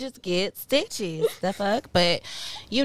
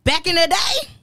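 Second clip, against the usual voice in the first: high, loud excited rant; 8 semitones higher, 4 dB louder than usual. Real, not acted. Old-school parents rant.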